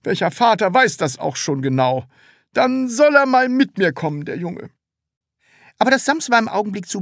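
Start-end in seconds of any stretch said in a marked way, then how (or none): 0.0-4.7 high, fixed-grin salesman voice; stiff smile. A narrator's reading. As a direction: say it through a frozen smile. Salesman voice continues.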